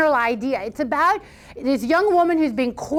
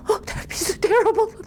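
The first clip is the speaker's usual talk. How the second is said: a mother caught up in the silly panic; hammed-up horrified mother; 8 semitones higher, faster than usual. Mother joins the despair.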